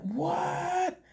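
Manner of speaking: very high, drawn-out imitation of disbelief; mock shock. Acts out woman's shock at confession.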